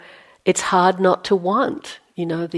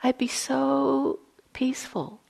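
whisper; 7 semitones higher, 5 dB quieter than usual. Retold or imitated. Dreamy recollection of peace.

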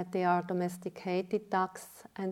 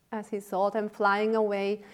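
usual speaking voice

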